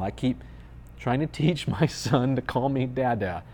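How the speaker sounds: ordinary talk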